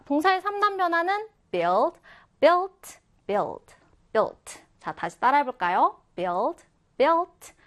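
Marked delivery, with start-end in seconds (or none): none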